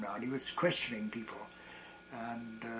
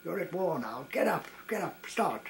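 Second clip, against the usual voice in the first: livid shout; 4 semitones higher than usual. Retold or imitated. Officer in a kilt yells at men resting on the bank, livid shouts. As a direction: drive the lagging men up with angry shouts. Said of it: "he was livid".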